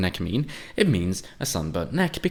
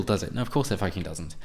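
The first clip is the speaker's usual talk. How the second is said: normal talking voice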